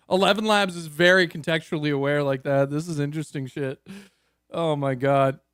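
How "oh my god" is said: normal talking voice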